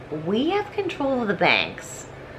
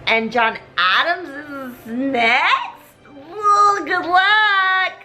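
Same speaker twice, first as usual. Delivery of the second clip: mocking high gloat; 5 semitones higher, much slower than usual. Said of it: "King George of England is just like".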